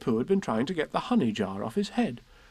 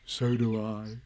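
Pooh; 6 semitones lower, 3 dB quieter, slower than usual. Acted. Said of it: "said Pooh".

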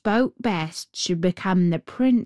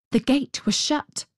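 ordinary delivery, nothing marked

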